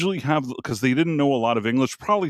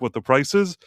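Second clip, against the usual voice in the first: normal talking voice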